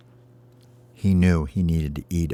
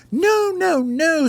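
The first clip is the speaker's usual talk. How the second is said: very high, pleading dragon wail; mock-terrified storybook dragon; panicked dragon; 4 dB louder, much slower than usual. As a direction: beg for mercy in a hammed-up squeal. Beaten dragon begs.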